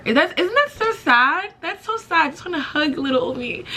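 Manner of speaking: high-pitched "aww, poor thing" voice; self-pitying coo. Real, not acted. Pitying her younger self.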